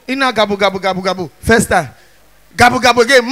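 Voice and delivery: ordinary talk, nothing marked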